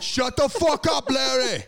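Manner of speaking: mock boss bellowing at Larry; mock shout. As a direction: yell Larry down.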